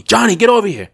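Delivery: high, urgent imitated call; calling someone over. Dealer summons the casino manager, calling him over. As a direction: mimic summoning someone from across the room. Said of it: "she calls him over".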